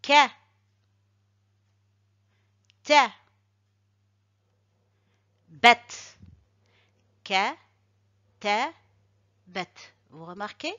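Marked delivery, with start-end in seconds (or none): 0.0-9.7 Ka, ta, bet said loud and separate with a stop after each; Ka ta bet read as three distinct beats; syllable by syllable. Shown as a demonstration. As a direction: punch each syllable then pause. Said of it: "marquer un petit arrêt après chaque syllabe".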